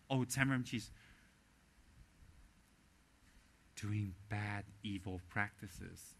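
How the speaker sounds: low, soft, slow mocking voice of the gossipers. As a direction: drop low and sneer it out slowly. Mocking what rival centers say.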